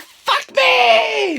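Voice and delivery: high, put-on lusty squeal; mock Lana squeal. Lana yanking the referee, hammed-up lusty squeal. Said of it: "she's like".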